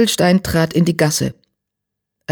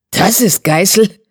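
slow, higher question, rising in disbelief; incredulous cry; 5 semitones higher, 4 dB louder than usual. A narrator's reading. Tigerstern sees the tiny leader, calling out incredulously. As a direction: blurt it out in disbelief. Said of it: "rief Tigerstern".

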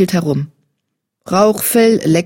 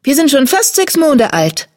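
announcing kitten; 9 semitones higher than usual. A narrator's reading. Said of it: "verkündete Disteljunges".

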